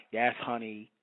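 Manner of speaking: slow, low robotic monotone; mock android voice. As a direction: drone it like a robot, mocking him. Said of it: "talking like a damn android".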